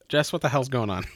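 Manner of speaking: normal talking voice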